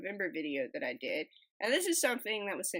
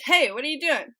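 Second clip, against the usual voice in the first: louder, higher joke-character call; 7 semitones higher, 11 dB louder than usual. Acted. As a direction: call up to someone in a tree. Lizard calls up to monkey in joke.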